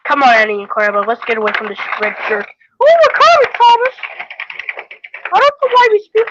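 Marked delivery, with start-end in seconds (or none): 2.8-6.3 high, louder toy coach voice. Acted. Coach answering Thomas in a high voice. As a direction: chirp it like a little coach.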